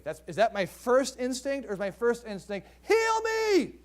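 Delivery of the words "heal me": high, pleading cry; desperate plea. Retold or imitated. Sick believer praying for healing, high pleading. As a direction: beg like a sick person.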